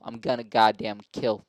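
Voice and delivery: ordinary talk, nothing marked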